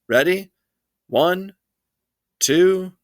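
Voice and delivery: high, spaced-out warning count; mother counting down at a tantruming child; counting to three. Acted. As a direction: give each number weight.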